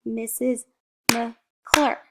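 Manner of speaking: "Missus McClure" broken into beats; syllable by syllable. Shown as a demonstration. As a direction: separate "McClure" as Mc, Clure. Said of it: "Or with my name".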